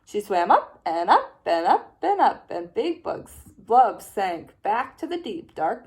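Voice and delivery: plain reading voice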